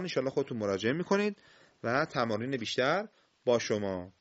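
ordinary talk, nothing marked

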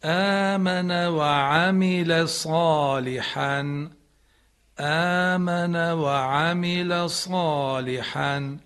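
slow آمن و عمل صالحا, صالحا not doubled; correct recitation model. Shown as a demonstration. Teacher corrects the student's errors, reciting slowly.

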